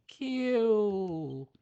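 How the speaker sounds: "Cute" cooed high and drawn out, falling; cooing "cute". Real, not acted. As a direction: melt over "Cute", up high. Cooing at the fairy.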